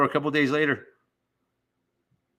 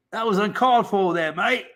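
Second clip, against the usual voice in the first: high-pitched, wronged Aussie kangaroo; Australian accent, rising on the last word; 7 semitones higher than usual. Acted. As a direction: complain in a put-on Australian accent. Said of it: "done a good Australian accent".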